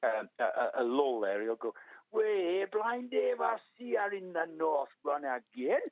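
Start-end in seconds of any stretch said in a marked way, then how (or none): 2.1-5.8 high-pitched, hammed-up Australian; mock Australian accent. Bloke hailing Blind Dave at the island, mock Australian accent. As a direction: imitate an Australian spectator greeting a runner. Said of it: "That's my great Australian accent".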